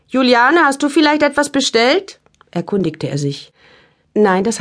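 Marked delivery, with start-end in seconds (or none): 0.1-2.2 calling out. Acted. Husband calls through house.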